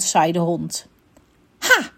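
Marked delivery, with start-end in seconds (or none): 1.6-1.9 high, sudden exclamation; called out. A narrator's reading. Unicorn catching out the rude dog, high exclamation. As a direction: burst out with it, pitched high. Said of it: "riep de neehoorn".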